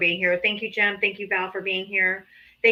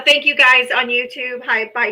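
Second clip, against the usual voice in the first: ordinary talk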